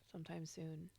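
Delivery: soft whispered aside. Real, not acted. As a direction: whisper it to yourself. Whispered aside about making tacos.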